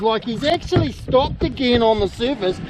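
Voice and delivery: normal talking voice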